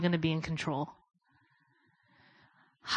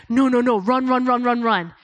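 louder, urgent inner alarm; mock panic; 3 semitones higher, 5 dB louder than usual. His head panicking at the dark cloud, urgent and loud. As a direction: hurry it like your head screams flee.

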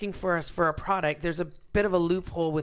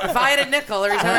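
laughing; 7 semitones higher, 8 dB louder than usual. Real, not acted. Laughing at a joke.

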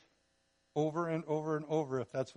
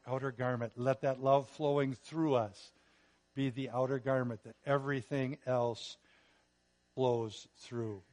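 ordinary delivery

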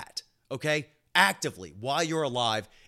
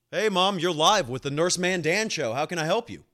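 higher, louder call-in show host greeting; radio host voice; 5 semitones higher, 7 dB louder than usual. Pretend. Podcaster answering his mom's call as if she's a caller, hammed-up radio host. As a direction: answer like a DJ taking a caller on air. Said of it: "see what old Mama Dukes has to say".

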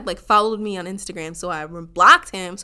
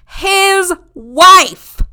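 outraged shout; 8 semitones higher, 14 dB louder than usual. Real, not acted. Shouting to viewers about the man's secret wife. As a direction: burst out loud and high with the reveal. Furious reveal of his wife.

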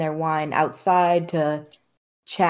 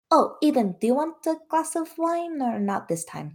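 put-on higher-pitched voice of a tiefling girl; 10 semitones higher than usual. Tiefling girl offers Eden wine, high-pitched girl's voice. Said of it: "The tiefling girl kinda stops and says".

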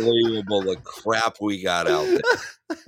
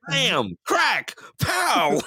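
high shouted bam crack pow; comic fight shouts; 11 semitones higher, much slower than usual. Pretend. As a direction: yell like a sixties comic fight. Batman fight scene sounds.

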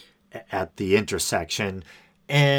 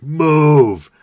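loud, higher, drawn-out yell; angry bellow; 6 semitones higher, 10 dB louder than usual. Retold or imitated. Guy yelling at the narrator in traffic, angry bellow. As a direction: imitate an angry driver bellowing at someone. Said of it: "this really angry, bellowing voice".

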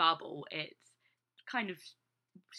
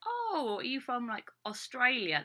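high, surprised mock question, "Oh" leaping way up; mimic questioner; 6 semitones higher than usual. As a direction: play the curious stranger guessing wrong. Mimicking people's guess.

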